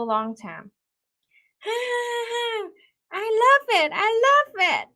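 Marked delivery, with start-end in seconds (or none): 1.6-2.7 delighted giggle. Retold or imitated. Girl delighted by bracelet. 3.1-4.9 high, loud squeal of joy; high delighted child's cry; overjoyed girl. Retold or imitated.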